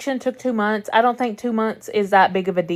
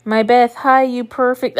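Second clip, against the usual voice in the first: ordinary talk, nothing marked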